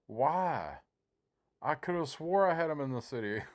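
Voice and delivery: high-pitched, exasperated disbelief; baffled protest. Retold or imitated. Retelling his baffled reaction in game.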